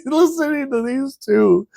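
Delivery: ordinary delivery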